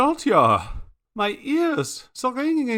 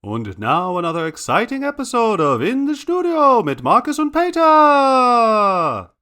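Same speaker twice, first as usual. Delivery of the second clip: put-on big German-accented announcer, swooping pitch; show announcer; 3 semitones higher, 6 dB louder than usual. Announcer introducing another exciting episode, grand German-accented flourish. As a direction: announce it grandly, stretching the final names.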